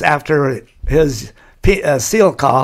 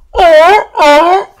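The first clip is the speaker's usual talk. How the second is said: very high, loud seal bark oh oh; seal call; 8 dB louder than usual. Retold or imitated. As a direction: yelp it out like a seal over the crowd. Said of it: "he would do the seal call".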